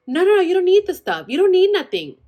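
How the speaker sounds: high loud flustered mom; panicky mom. Retold or imitated. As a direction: brush it off in a rush.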